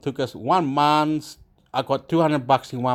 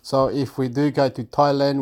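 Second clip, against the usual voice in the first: normal talking voice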